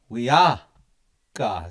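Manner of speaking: WE ARE, pause, then ka; pause before ka. Shown as a demonstration. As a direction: break it as "We are … ka" with a clear gap. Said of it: "See the pause here?".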